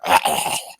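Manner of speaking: throaty rasping creature hiss; crocodile hiss. Acted. Crocodile kicked in the head lets go of the foot, hissing. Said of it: "like, hisses at him".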